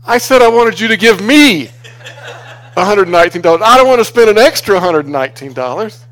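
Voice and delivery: replaying his indignant outburst at God; exasperated complaint to God.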